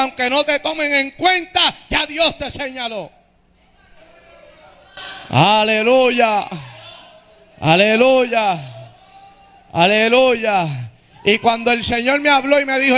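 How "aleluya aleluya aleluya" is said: drawn-out hallelujahs. Real, not acted. Preacher shouts praise, slow.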